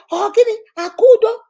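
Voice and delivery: high loud mock husband voice; shouting for his sister on the phone; husband grabbing phone. Man grabbing the phone, loud high voice.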